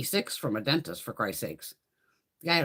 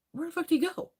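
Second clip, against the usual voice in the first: incredulous; 10 semitones higher, faster than usual. Retold or imitated. Retold confusion at dentist.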